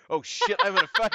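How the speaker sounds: startled gamer panic; mock panic. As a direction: squeak it out like you just realised the danger. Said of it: "and I was like".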